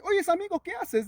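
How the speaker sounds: a hint of a curious bird calling down; sopilote's voice. Sopilote voiced in folk tale. (voz de zopilote apenas marcada, más aguda)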